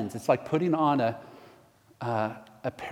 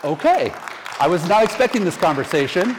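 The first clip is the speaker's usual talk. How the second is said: surprised; 5 semitones higher, 7 dB louder than usual. Real, not acted. Surprised by baptism request.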